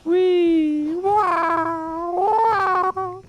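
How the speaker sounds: squeaky cartoon-like wee wah wah; high squeaky wee wah. Streamer squealing playfully at the game start.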